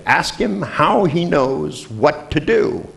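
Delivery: high, very slow, spelled-out request; slow relayed instruction. Retold or imitated. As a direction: space it out for someone on a bad line. Dictating question through caller and translator.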